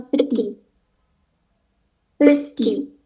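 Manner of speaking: petit tanpa bunyi T di ujung; petit, berhenti di I; silent final T. Shown as a demonstration. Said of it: "huruf T terakhirnya itu nggak dibaca". (petit dibaca peti)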